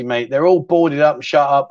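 ordinary talk

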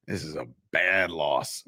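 slow, low impersonated voice; Jimmy Palumbo. Acted. Host doing his best Jimmy Palumbo on the Giants' loss, slow impression. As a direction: drag it out low, like doing someone's voice. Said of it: "in my best Jimmy Palumbo voice".

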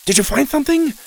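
high, eager, quick; hopeful and excited. A narrator's reading. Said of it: "scrambled over excitedly".

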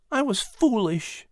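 put-on high boy's voice, slow and a little soft; boy admitting a fault; ashamed Patrick. A narrator's reading. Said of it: "Patrick replied softly".

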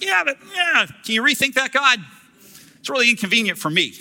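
high, fast wheedling plea to God; whiny pleading. Retold or imitated. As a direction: beg God like a whiny kid.

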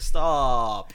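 loud pleading stop; loud mock plea. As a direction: beg them to stop. Retold disgust.

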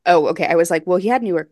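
ordinary delivery

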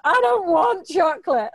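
mock a tearful, stubborn grown child; imitating crying self. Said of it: "I'm just crying".

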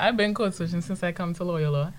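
usual speaking voice

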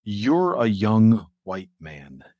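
very slow, professorial pronouncement; Lucian Hold. Retold or imitated. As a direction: imitate an uptight gatekeeper, drawing out each word. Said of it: "very professorial".

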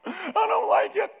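high, loud, laughing mock fright; spooked laughing. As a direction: laugh through a hammed-up scared kid. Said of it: "getting spooked by my shadows".

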